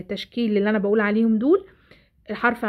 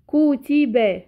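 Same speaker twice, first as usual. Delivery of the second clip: "كتب" in long separate syllables, louder; sounded out slowly; 3 semitones higher, 5 dB louder, much slower than usual. Shown as a demonstration. Teacher shows the next word to pupils, "كتب" sounded out syllable by syllable. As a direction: stretch out each syllable of "كتب". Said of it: "بحلل صوتي".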